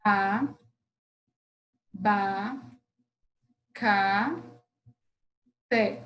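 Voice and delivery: "A, ba, ca, te" with long pauses between; syllable by syllable. Shown as a demonstration. Teacher counting syllables of abacate, "A, ba, ca, te" separated. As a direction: count out "A, ba, ca, te" as isolated beats. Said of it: "Vamos lá, contando".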